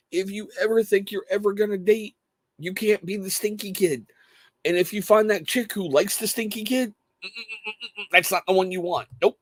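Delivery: high-pitched, incredulous parent lecture; dad's mock lecture. Dad acts out lecturing his son.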